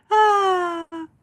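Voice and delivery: high, loud burst of laughter; real laugh. Real, not acted. Vlogger cracking up at her own naive story, high laugh. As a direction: crack up at the memory.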